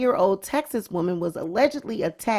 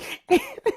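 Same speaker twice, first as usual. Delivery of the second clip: very high-pitched laugh burst; helpless shrieking laugh; high squealing laugh. Real, not acted. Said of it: "I can't".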